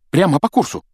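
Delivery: high-pitched call-out; excited call. A narrator's reading. Harry points Ron to what lies ahead, excited call. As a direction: call it out like a lookout. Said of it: "вдруг крикнул Гарри".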